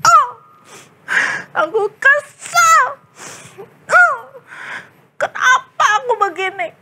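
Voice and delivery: high, loud wailing whine; hammed-up crying about lost viewers; mock sobbing. Vlogger boy sobbing over falling views, mock crying. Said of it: "Nangis dia".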